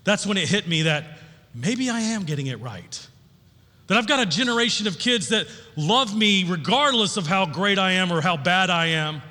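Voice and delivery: ordinary talk, nothing marked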